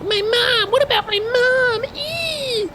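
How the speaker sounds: very high, slow mock wail; wailing child. Streamer mimicking Haruka crying about her mom, very high squeal. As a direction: squeal like a frantic little girl. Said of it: "she's like".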